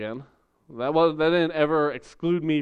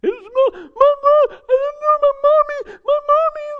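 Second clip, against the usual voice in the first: put-on much higher, loud wailing little kid; crying child; 7 dB louder than usual. Lost boy crying to the cashier for his mommy. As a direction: wail like a lost five-year-old. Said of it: "like, crying".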